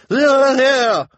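extremely high, very loud cheer; shrieked agreement. A narrator's reading. Missus Morrow outdoes the others in agreeing, piercing cry. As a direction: shriek your support. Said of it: "Missus Morrow cried".

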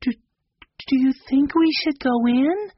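timid. A narrator's reading. Girl asking her brother timidly whether to go in. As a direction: stumble over the first word, small and unsure. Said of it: "Violet asked timidly".

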